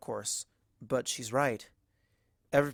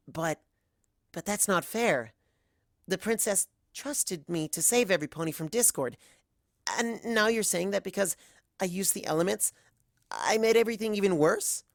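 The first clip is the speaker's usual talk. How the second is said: higher, voice climbing in dismay; dismayed filly; 7 semitones higher than usual. Acted. Other Twilight learning her efforts made things worse, high and dismayed. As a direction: fight the news, rising to disbelief.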